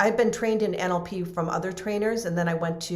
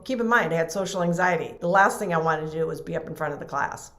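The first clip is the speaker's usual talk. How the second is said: ordinary talk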